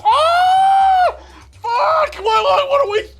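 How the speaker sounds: very high, loud panicked yelping; panicked shriek. Retold or imitated. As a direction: screech it out like total panic. Panicking friends after botched buzz.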